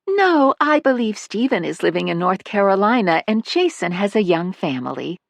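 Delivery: high, light motherly voice; a fond older mother chatting about her son; elderly mother. Acted. Narrator voices Missus Manley.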